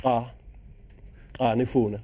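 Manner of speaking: "nifuna" spoken in Swazi after clicked "Ah, ah"; Swazi click word. Shown as a demonstration. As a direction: click the tongue on "Ah, ah", then say "nifuna". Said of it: "it's a click language, so the word for no".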